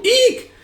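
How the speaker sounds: very high mock shriek; hammed-up high "eek"; frightened squeal. Men recoil from drawn sword.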